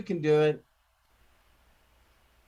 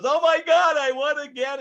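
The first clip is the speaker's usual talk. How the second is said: high, gushing mock delight; hammed-up girlish thrill; Lisa's giddy win; 10 semitones higher, 5 dB louder than usual. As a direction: imitate an amazed lucky winner. Acts lucky Lisa winning again.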